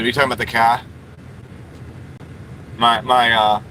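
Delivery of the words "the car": put-on car with a Boston accent, no R, "kah". Streamer echoing a viewer's question about the car, Boston accent. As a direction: drop the R in "car", Boston style. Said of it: "that's Sean's accent".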